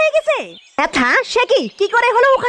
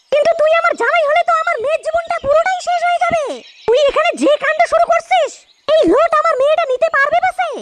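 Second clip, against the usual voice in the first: mother-in-law voice; 5 semitones higher than usual. Acted. Mother-in-law scolding son-in-law.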